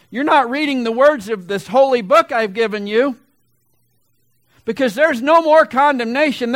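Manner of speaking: loud, high preaching voice of God; God exhorting loudly. Acted. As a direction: boom it out as God insisting. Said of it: "And God says".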